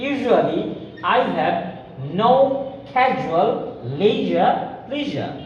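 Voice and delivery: very slow, careful zh sounds; slow drill sentence. Shown as a demonstration. Teacher drilling the sentence, slow. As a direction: draw out casual leisure pleasure. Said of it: "আমাদের speaking better হবে".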